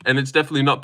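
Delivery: ordinary talk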